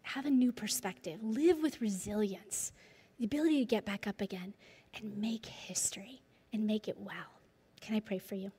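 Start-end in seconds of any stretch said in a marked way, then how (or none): none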